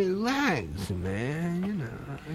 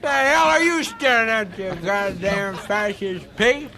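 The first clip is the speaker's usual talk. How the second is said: angry sneer; 2 semitones higher, faster than usual. Acted. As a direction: spit the insults out. Defendant insults the bailiff.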